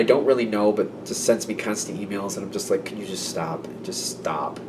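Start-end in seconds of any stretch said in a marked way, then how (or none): 2.9-4.6 very soft, low exasperated plea; weary plea. Acted. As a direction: beg under your breath, worn out. Acted weary plea to email sender.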